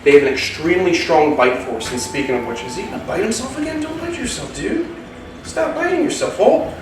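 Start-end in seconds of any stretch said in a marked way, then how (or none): 2.6-6.7 high, soft scolding of a pet snake, quickened at the start; high pet talk. Real, not acted. Keeper softly scolds his snake, high pet voice. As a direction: gently tell off a biting snake.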